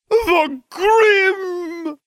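a high, loud, alarmed cry; mystic's cry of horror. A narrator's reading. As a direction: shriek it like a seer struck by doom. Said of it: "Trelawney cried".

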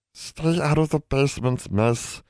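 put-on high old elf voice, warning. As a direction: caution her as an old elf. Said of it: "the old elf warned".

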